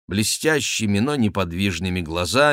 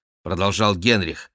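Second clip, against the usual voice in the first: ordinary delivery, nothing marked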